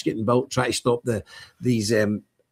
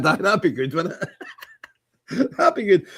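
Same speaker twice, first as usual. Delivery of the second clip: laughing through the words; amused laughing; 4 semitones higher, much slower than usual. Real, not acted. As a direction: chuckle while you talk, tickled by the idea. Amused at his own joke.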